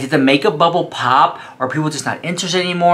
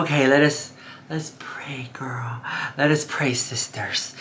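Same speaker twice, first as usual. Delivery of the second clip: hushed, whispery pretend prayer; softly leading a mock prayer; 11 dB quieter than usual. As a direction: drop to a near-whisper for a playful prayer.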